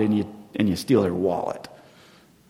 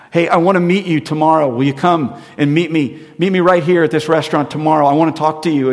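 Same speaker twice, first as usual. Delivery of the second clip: loud, high call across a busy street; yelling across the street; 5 semitones higher, 7 dB louder than usual. Retold or imitated. Wallet owner calls after the fleeing thief, loud yell. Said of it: "And they're yelling".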